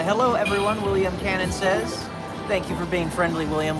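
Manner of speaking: ordinary talk, nothing marked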